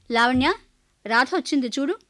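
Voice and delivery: father calling his wife to come see. Acted. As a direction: call her name across the house.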